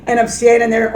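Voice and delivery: ordinary delivery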